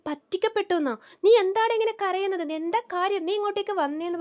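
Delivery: a mother suddenly alarmed, demanding answers; mother asking her sobbing son what happened. Retold or imitated. Mother questions her crying son.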